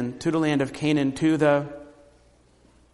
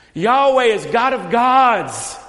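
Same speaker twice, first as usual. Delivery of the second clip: slow, loud preaching declaration; proclaiming; 5 semitones higher, 6 dB louder, slower than usual. Shown as a demonstration. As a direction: announce it with conviction. Preacher proclaims their response.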